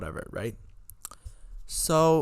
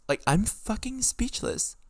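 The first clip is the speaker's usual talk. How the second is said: stunned; 10 semitones higher than usual. Real, not acted. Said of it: "I'm speechless".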